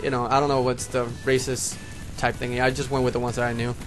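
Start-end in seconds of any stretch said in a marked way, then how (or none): none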